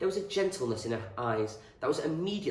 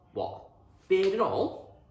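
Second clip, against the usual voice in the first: slow, higher and louder teasing question; mock disbelief; 10 semitones higher, 7 dB louder, slower than usual. A narrator's reading. Boy teasing his friend about Mrs Reynolds, mock disbelief. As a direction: draw it out, rising in disbelief.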